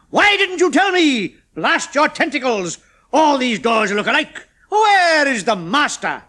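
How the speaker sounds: loud, high, slow exasperation; berating. A narrator's reading. Chemist scolding the humanoid, loud and high. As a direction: blast the humanoid.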